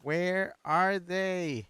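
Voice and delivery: slow drawn-out plea. Real, not acted. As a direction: stretch every word in desperation. Asks twice, second drawn out.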